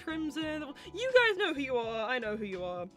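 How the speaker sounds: ordinary talk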